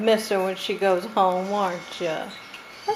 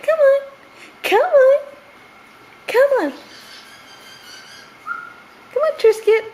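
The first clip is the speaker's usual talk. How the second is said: high, loud repeated call; calling the bird; 6 dB louder than usual. Real, not acted. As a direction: call her over, bright and loud.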